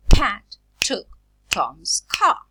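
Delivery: slow, loud "Pat took Tom's car" with a puff after P, t and c; aspirated plosives. Shown as a demonstration. Teacher showing initial plosives, "Pat took Tom's car" slowly and strongly aspirated. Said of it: "Initially, initially, in stressed syllables".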